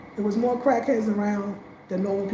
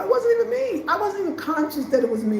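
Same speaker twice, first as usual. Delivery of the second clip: ordinary talk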